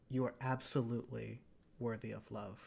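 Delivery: put-on sweet boy tells his date she is loved, soft and sincere. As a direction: say it gently and earnestly. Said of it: "with all the sincerity of".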